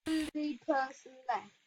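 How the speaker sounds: soft, deflated echo of the last line; quiet repeat. Real, not acted. Alone in the game, repeating it to himself, quiet and deflated. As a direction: mutter it again under your breath.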